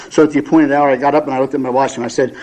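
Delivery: ordinary delivery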